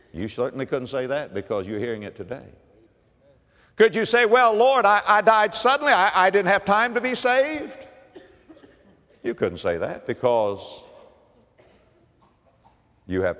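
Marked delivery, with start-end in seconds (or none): 3.8-7.9 high, loud pulpit challenge; challenging preaching. Real, not acted. Preacher voices a sinner's excuse before God, high and challenging. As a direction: throw the imagined excuse at the congregation.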